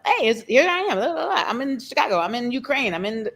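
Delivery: sing-song her travel check-ins like an eager texter; mock chatty self. To the host, mimicking her chatty travel updates. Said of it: "that's me".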